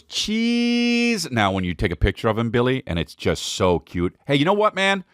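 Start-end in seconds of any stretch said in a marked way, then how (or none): 0.0-1.3 put-on high squeaky kid saying cheese for the photo; tiny high-pitched kid voice; little kid voice. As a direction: chirp it like a toddler posing. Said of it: "he goes".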